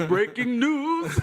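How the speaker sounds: high, slow laughing anchorman imitation; imitating news anchor. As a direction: mimic the newsman while laughing. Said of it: "sound like the anchor man".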